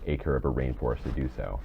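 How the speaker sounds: ordinary talk, nothing marked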